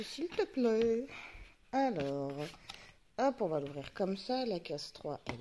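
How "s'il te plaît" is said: pleading. Real, not acted. Pleading with camera to focus.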